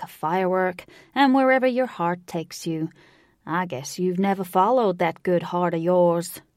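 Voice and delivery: put-on loud Southern old-timer giving a pep talk; big elderly Southern American voice; encouraging old Southern cowboy. A narrator's reading.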